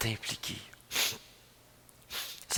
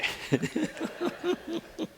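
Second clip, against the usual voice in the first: laugh; 7 dB quieter than usual. Real, not acted. Laughs recalling choking on smoke.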